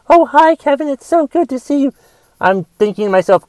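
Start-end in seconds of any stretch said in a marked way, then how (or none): 0.1-1.9 high, sugary mock-friendly voice; fake-nice greeting. Mocking a phony person greeting him with fake sweetness. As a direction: imitate a phony acquaintance gushing hello. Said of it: "anytime I hear somebody say".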